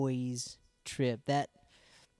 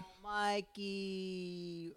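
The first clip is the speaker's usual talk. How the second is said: put-on slow, drawn "Oh, Mikey" in a New York accent; 5 semitones higher, 6 dB quieter, much slower than usual. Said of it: "echoing the New York guys".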